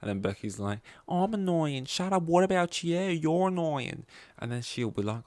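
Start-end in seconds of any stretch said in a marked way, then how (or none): 1.1-4.0 high, bickering Aussie soap voice, last line drawn out slowly; bad Australian accent. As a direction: fling it back in a hammed Aussie accent. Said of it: "convoluted way of bad accents".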